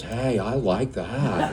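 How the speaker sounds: slow, low, lazy drawl of a friend; mock his slow easy way of talking to his face; lightly voiced laid-back songwriter, amused. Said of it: "That's how he talks".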